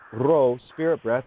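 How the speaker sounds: ordinary talk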